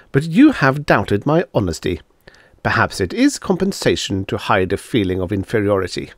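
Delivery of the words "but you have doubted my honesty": ordinary delivery, nothing marked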